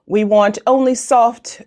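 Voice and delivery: ordinary talk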